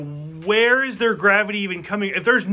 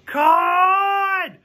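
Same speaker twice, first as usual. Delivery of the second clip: a long drawn-out mock yell, much higher and louder; imitating Kirk's yell; 10 semitones higher, 4 dB louder than usual. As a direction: ham up the famous scream of the villain's name. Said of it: "where he yells".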